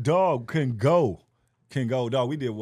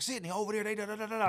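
high, soft babbled summons; imitating a headliner; 8 semitones higher, 4 dB quieter, faster than usual. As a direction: call the name high, then trail into filler. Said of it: "the first headline was like".

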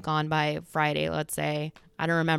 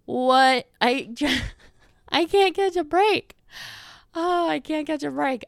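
high, loud comic exasperation; mock-despairing high wail; exasperated; 9 semitones higher, 6 dB louder than usual. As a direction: laugh in disbelief at your bad luck. Acts out exasperation at swollen eye.

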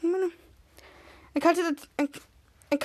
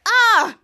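loud high yelp; a zapped cartoon cry; mock pain cry; 6 semitones higher, 7 dB louder than usual. Ray zapping back, a loud yelp. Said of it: "did the same thing to him and said".

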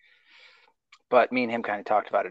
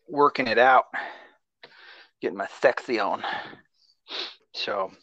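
ordinary delivery

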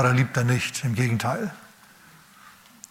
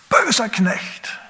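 zorniger König; 7 semitones higher, much slower than usual. Acted. König spricht den Knecht an.

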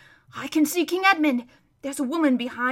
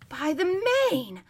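higher, slow startled cry; startled horse; 5 semitones higher, slower than usual. Acted. Bree to the others, startled at seeing his master. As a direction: Bree suddenly recognizing his old master. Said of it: "said Bree".

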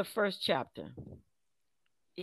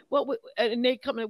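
ordinary delivery

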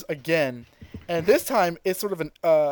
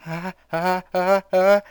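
gasping for breath; 4 semitones higher, slower than usual. Retold or imitated. Krillin choking as he spots Frieza, gasping. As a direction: gasp for air as if choking. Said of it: "starts losing his breath".

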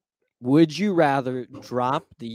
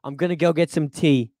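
raised-pitch mock-British grumble; British accent; 4 semitones higher, 6 dB louder than usual. Ending the insult game by leaving for tea, mock-British. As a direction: ham up a British put-down.

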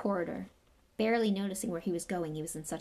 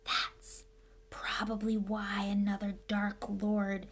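hushed whisper. A narrator's reading. Ernie gossiping to the Hufflepuffs about Harry, whispering. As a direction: lean in and whisper the rumour. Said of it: "He dropped his voice until it was barely more than a whisper".

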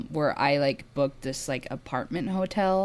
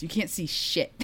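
ordinary talk, nothing marked

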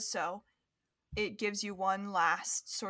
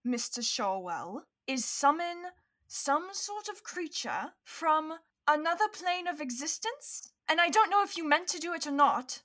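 higher, emphatic, measured then quicker; indignant confrontation; 6 semitones higher than usual. Acted. Hermione confronting Lucian over the summoned creature, indignant. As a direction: lay out the accusation firmly.

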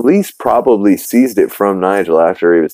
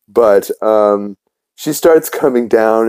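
ordinary talk

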